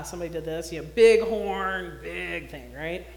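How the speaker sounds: ordinary delivery, nothing marked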